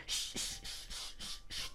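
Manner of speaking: soft unvoiced shushing, like rocking a baby; whispered shh to a fussy infant. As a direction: hush the baby to sleep. Hushing a crying baby.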